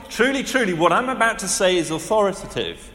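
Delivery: usual speaking voice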